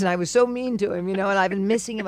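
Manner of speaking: ordinary delivery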